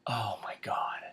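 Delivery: awed whisper; whispered aside. Real, not acted. The reader reacting to the gold continent in a whisper. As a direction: breathe it out in stunned awe.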